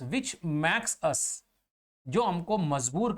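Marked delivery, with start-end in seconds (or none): 0.0-1.4 slow, spaced words for students to copy; slow dictation pace. Shown as a demonstration. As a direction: read it out slowly like notes. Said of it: "dictating notes".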